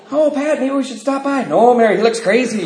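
high-pitched imitation of wary onlookers; mock passing couple. Voices a worried couple driving by.

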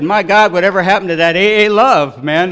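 loud, high, outraged disbelief; indignant outcry. Retold or imitated. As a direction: shout it as if betrayed by friends. Indignant reaction to being refused.

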